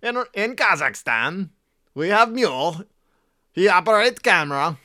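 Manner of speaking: hammed-up Borat bragging. Imitating Borat to make his friend laugh. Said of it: "It's Borat".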